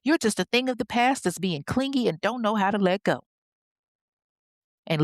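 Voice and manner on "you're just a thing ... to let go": mildly acted sassy retort, pitched high; hint of a bold rival talking back; bold comeback. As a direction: fire back with a touch of attitude. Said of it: "gets bold and fires back".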